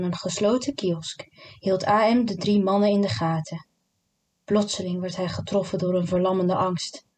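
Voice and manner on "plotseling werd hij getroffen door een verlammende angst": plain reading voice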